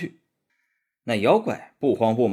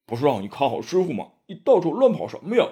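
fast, rising to a louder scolding; grumbling complaint; 4 semitones higher, 3 dB louder, faster than usual. A narrator's reading. Pigsy blaming Sandy for losing master, fast scolding. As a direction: nag your brother for wandering off. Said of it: "猪八戒埋怨沙和尚".

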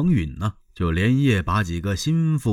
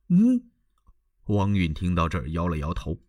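plain reading voice

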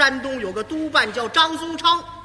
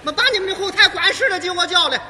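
put-on bossy old lady; 6 semitones higher, 6 dB louder than usual. Old lady demands the manager.

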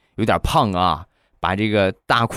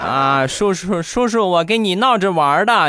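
put-on high, sweet wheedling kid voice; placating child; 10 semitones higher than usual. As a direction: suck up to the uncle. Nephew backs down, flattering.

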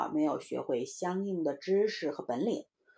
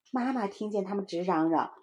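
usual speaking voice

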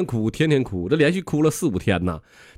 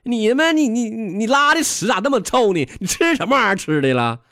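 higher-pitched stammering mock outrage; disgusted complaint; 7 semitones higher than usual. Farmer argues back at crow.

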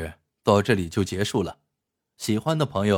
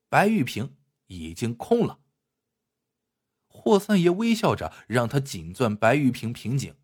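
higher, animated storyteller's voice; story narration; 4 semitones higher than usual. A narrator's reading. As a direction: narrate the tale with lively pitch swings. (评书式故事旁白)

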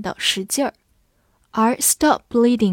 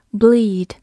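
normal talking voice

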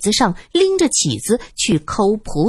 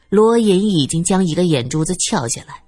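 ordinary delivery

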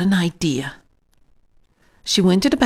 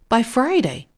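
plain reading voice

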